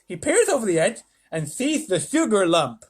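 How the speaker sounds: president voice. Acted. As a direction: describe it like a showman. President narrates fly.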